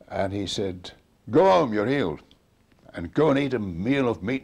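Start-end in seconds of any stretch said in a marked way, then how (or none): 1.3-4.4 higher and much louder, a ringing order; loud command. Retold or imitated. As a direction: bark the healing like an order. Commanding the sick boy.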